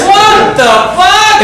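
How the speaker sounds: high loud incredulous shout; shouted disbelief. Real, not acted. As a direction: yell it in disbelief.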